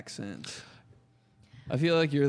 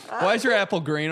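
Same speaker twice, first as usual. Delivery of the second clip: louder, higher, laughing imitation; laughing mock question; 6 semitones higher, 7 dB louder than usual. Acted teacher question while laughing.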